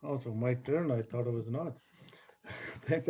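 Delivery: ordinary talk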